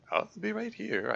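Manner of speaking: put-on girl voice. Young girl character reply.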